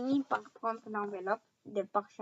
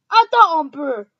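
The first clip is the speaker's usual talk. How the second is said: loud angry warning; angry giant; 5 semitones higher, 16 dB louder than usual. A narrator's reading. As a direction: hold on, threatening and loud. Hagrid angry.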